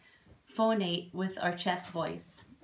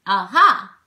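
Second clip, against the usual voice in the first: loud, projected chest-voice exclamation; 13 dB louder than usual. Shown as a demonstration. Teacher contrasting chest with head voice, loud chest-voice call. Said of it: "as opposed to the deeper chest voice".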